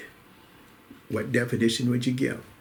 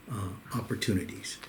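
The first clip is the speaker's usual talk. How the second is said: ordinary delivery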